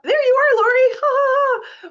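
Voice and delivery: light up as Lori appears; delighted greeting. Real, not acted. Greeting a returning participant.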